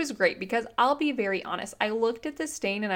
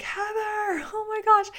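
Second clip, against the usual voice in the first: much higher, shocked cry of her name; a high, alarmed "Heather, oh my gosh"; alarmed brother; 13 semitones higher than usual. Retold or imitated. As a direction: imitate the brother spotting the spill. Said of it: "he was like".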